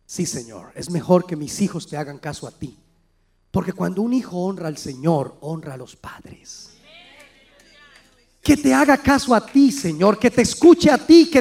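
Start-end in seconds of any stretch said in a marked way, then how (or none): 0.0-6.7 very low, hushed, humbled answer; humble quiet reply. Retold or imitated. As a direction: give in softly to the Lord. (respuesta humilde y muy baja)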